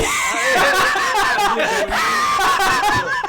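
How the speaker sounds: big burst of loud laughter; howling with laughter. Real, not acted. Explodes laughing at the name.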